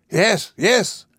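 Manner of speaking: two high-pitched excited bursts; excited cry. A narrator's reading. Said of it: "he cried".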